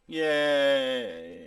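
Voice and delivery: long, drawn-out token cheer; mock half-hearted cheer. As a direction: imitate a barely enthused crowd. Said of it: "made it seem like they were like".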